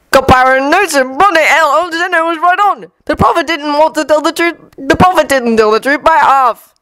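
worked-up publican; cockney barman voice. Acted.

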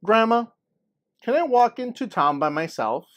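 put-on high, loud child's plea to grandma; eager little girl. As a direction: ask like a hopeful young girl. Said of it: "I asked".

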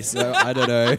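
laughing loudly through the words. Real, not acted. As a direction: break into a laugh while saying it. Joking about avoiding the movie.

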